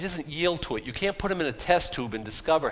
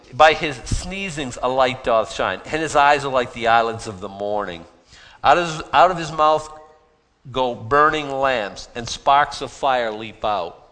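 scripture reading; 3 semitones lower, 4 dB louder than usual. Acted. Reads Bible verse aloud.

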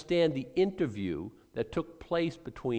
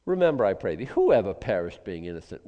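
quoting Eliphaz; 5 semitones lower, 5 dB louder than usual. Retold or imitated. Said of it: "Eliphaz would have made a great health and wealth gospel guy".

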